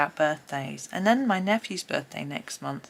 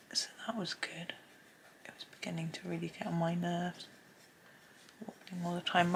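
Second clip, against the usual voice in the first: soft whispered aside; whispering; 7 dB quieter than usual. Real, not acted. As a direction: whisper it while drawing. Whispered chat while drawing.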